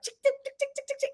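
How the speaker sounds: high ticking noise, like a clock; ticking sounds. Retold or imitated. Telling the hosts the date got tense, ticking-bomb noise. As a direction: tick it off like a time bomb. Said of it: "everything started being".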